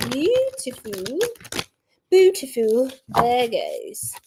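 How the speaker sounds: slow words stretched by a yawn. Real, not acted. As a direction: talk through a big yawn. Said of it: "I'm yawning".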